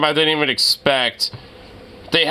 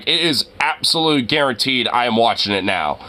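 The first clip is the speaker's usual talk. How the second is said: ordinary talk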